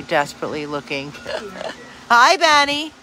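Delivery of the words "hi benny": high, loud call to the dog. Real, not acted. Calling the dog excitedly.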